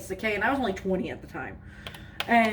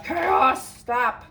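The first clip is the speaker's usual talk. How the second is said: sharp raised call at the cats, a bit higher; real shout at cats; 5 semitones higher, 4 dB louder than usual. Real, not acted. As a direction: yell stop at the naughty cats. Yelling at misbehaving cats.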